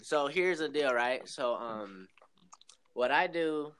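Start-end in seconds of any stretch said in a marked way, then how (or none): none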